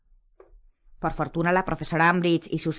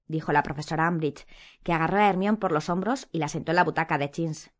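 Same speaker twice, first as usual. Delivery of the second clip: usual speaking voice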